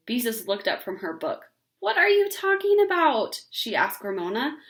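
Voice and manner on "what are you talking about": much higher, louder incredulous question; can't believe what she just heard; baffled big sister. Acted. Beezus to her little sister, incredulous.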